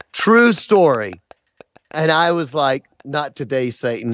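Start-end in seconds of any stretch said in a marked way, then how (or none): 3.0-4.1 low deadpan under breath; muttered quip. Retold or imitated. Inner defiant quip.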